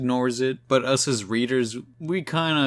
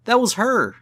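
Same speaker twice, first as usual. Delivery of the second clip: high, loud blurted accusation; acted squawk of blame; accusing shout; 13 semitones higher, 7 dB louder than usual.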